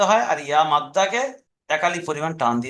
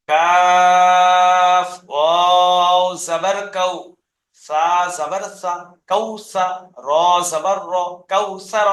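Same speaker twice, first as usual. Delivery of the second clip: "কাফ" and "wow" held long, each part set apart; spelled piece by piece; 4 dB louder, much slower than usual. Shown as a demonstration. Teacher showing how to spell a word, slow segmented chant. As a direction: chant it in separate pieces, then join up "Kawsar". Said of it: "এরকম কেটে কেটে বানান করবেন".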